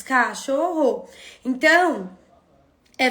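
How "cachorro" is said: cachorro slowly, ca-cho-rro; slow syllable-by-syllable "Cachorro"; split into syllables. Shown as a demonstration. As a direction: break "Cachorro" into three spoken beats. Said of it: "Quantos pedacinhos tem".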